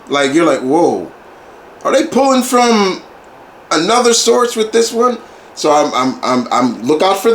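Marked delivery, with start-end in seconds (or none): 0.6-5.2 higher, amazed disbelief; wide-eyed wonder; mock amazed whoa. Reacting in disbelief to the movie.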